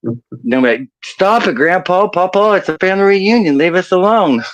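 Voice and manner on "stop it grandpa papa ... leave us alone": high, whiny mock protest; higher-pitched play-acted complaint; pleading grandkid.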